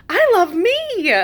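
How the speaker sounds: very high, slow, elated; joyful self-love. Retold or imitated. Realizing she loves herself, high slow elation. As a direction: savor it in wonder.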